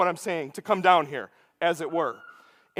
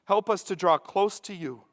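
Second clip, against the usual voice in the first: soft, low closing prayer; 5 semitones lower, 5 dB quieter than usual. Acted. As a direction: pray it quietly to Jesus, like a humble plea.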